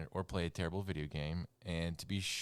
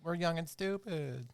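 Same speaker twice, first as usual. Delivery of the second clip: put-on whiny teen; 10 semitones higher, slower than usual. Mocking the movie's teenagers.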